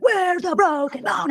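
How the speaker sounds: high, slow mock shriek; imitating a screaming woman. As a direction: drag it out in a shrill mock panic. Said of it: "And she was screaming".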